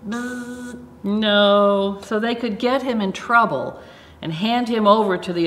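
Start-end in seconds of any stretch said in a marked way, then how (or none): 0.1-0.8 buzzing buzzer noise; wrong answer buzzer. Pretend. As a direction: buzz like a game show buzzer. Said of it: "make a buzzer sound like this".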